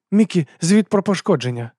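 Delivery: high, urgent request; concerned woman commanding. A narrator's reading. Abena demands a damage report.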